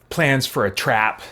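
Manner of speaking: normal talking voice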